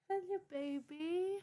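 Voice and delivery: high, slow pet greeting. Real, not acted. Streamer greets her cat, high slow baby talk. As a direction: coo hello to the cat.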